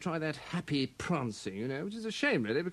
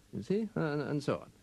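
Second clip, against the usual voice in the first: ordinary talk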